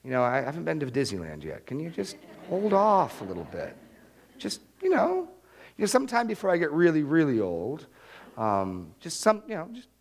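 hemming and hawing, with false starts; acted reluctant prayer, tripping over itself; hesitant bargaining.

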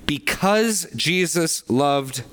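"Because" drawn out, each word weighed slowly; slow and emphatic. Shown as a demonstration. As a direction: spell out the causality, word by word. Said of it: "the Greek here is emphatic".

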